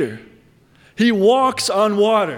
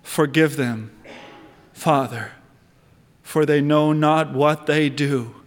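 tender plea; 5 semitones lower than usual. Retold or imitated. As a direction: lower voice, say it gently as a dying prayer. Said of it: "what did he say".

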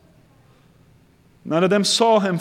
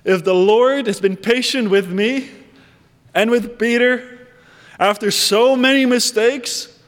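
ordinary talk, nothing marked

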